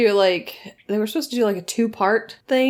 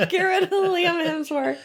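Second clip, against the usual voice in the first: laughing; 9 semitones higher than usual. Retold or imitated. Fans demanding Netflix.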